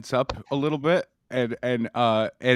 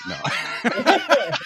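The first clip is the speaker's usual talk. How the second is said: much higher strangled no; mock impression; 10 semitones higher than usual. Comic imagining a friend's impression act, silly voice. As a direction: squeak out a silly voice.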